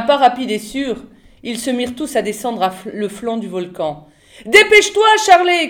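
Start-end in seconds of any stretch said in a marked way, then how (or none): 4.4-5.7 high, loud call to hurry; loud, high-pitched urging; urgent shout. A narrator's reading. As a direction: shout to get someone moving. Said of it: "cria Jeeves".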